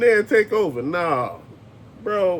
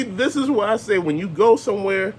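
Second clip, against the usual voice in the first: ordinary delivery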